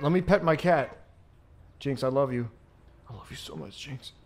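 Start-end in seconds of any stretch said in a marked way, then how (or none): 1.8-4.1 very soft, low murmur; tender. Real, not acted. Owner telling the cat Jinx "I love you", tenderly hushed. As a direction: fade into a hushed endearment.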